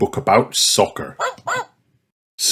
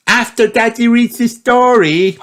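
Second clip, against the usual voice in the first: put-on much higher, louder little girl voice; Peppa insisting; 3 dB louder than usual. A narrator's reading. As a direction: push it like a child who won't go to bed. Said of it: "Peppa insists".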